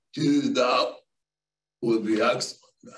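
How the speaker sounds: put-on a touch of the old hippie agreeing; mellow hippie. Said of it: "he was like".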